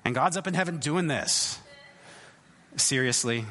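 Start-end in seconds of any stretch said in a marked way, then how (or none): 2.8-3.5 exasperated eye roll. Retold or imitated. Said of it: "just rolling his eyes going".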